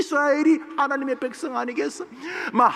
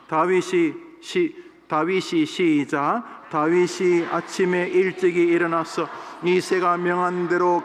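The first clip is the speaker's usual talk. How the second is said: lower, even Bible reading voice; scripture reading; 6 semitones lower than usual. Acted. Pastor reading Samuel to the congregation, solemn reading. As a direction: read the verses steadily and solemnly.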